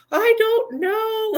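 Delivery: high, slow, wailing cry-voice; hammed-up tearful wail; mock crying whine. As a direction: act out sobbing helplessly. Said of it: "And I'm like".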